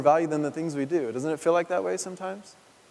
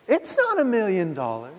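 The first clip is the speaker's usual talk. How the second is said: a hint of the child's complaint, only slightly higher; quick light echo of the kid's line; lightly voiced little girl; 4 semitones higher than usual. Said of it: "and then within a couple years".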